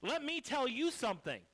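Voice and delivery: loud, high, heated retort; angry snapback. Retold or imitated. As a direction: fire back hard at them. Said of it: "That's what I did".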